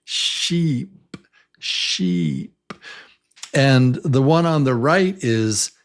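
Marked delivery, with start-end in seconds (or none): 0.0-2.5 sheep with a long, stretched E. Shown as a demonstration. Teacher models sheep for the class. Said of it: "like in sheep".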